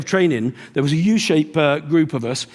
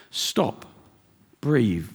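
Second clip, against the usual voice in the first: calm instruction; 5 semitones lower, 6 dB quieter, much slower than usual. Shown as a demonstration. Calming demo of advice.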